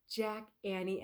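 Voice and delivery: ordinary delivery, nothing marked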